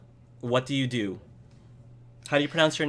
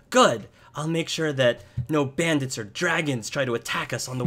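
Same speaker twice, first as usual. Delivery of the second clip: put-on higher, keen child voice; little boy protector; 5 semitones higher than usual. Boy promising to guard the way, eager child voice. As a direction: declare it like a small boy on guard duty. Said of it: "Jory says".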